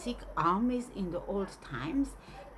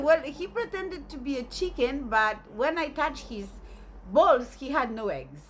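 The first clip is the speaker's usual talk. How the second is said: indignant grandmother; 5 semitones higher, 5 dB louder than usual. Retold or imitated.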